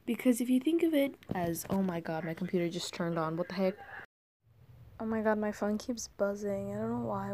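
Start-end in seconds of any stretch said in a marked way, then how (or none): none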